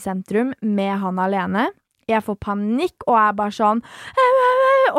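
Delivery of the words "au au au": mock panic. Panicking alone with him.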